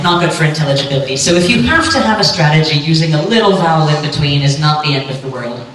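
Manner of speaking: ordinary talk, nothing marked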